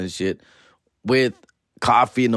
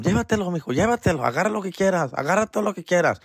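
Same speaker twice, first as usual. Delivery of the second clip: imitating mom; 6 semitones higher, faster than usual. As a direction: play his indulgent mom giving everything. Mom voiced in story.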